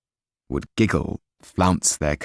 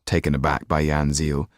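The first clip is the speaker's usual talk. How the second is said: ordinary delivery, nothing marked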